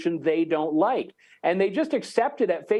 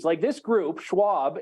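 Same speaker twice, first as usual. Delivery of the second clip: ordinary talk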